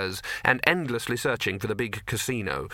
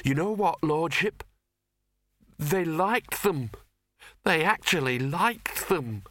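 whining servant; 5 semitones higher, slower than usual. Acted. Servant voiced in story.

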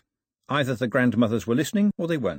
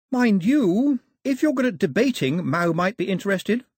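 high-pitched, encouraging suggestion; consoling girl; 9 semitones higher, 4 dB louder than usual. A narrator's reading. Daphne consoling the bishop with an idea, encouraging. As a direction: offer a hopeful alternative, pitched high.

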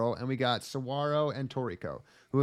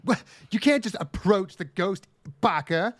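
put-on higher, louder scolding anime girl; 6 semitones higher, 4 dB louder than usual. Anime girl scolds friend about the ghost. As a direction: scold like a tsundere heroine. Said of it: "she'd be like".

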